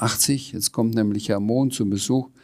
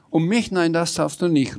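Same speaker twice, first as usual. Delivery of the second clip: higher-pitched, mildly alarmed refusal; called-out protest; 8 semitones higher than usual. A narrator's reading. Ralfa refusing to be wrapped in string, exclaimed protest. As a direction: lift the voice in startled objection. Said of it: "rief Ralfa".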